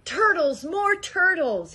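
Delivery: loud, high braying call; bellowing. A narrator's reading. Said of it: "he bellowed and brayed".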